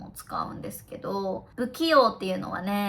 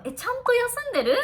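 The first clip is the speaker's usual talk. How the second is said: high, concerned check-in on a friend; caring high-pitched question; worried friend; faster than usual. Retold or imitated. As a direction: ask a tired friend if she's resting. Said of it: "私が絶対言う言葉".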